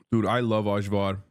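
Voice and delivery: usual speaking voice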